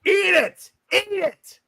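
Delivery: high gloating taunt. Real, not acted. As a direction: rub it in with a high-pitched sneer. Taunting a losing friend.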